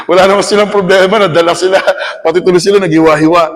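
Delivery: chuckling through the words; laughing. Real, not acted. Teacher to audience, laughing about copycat students. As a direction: talk while laughing at the absurdity.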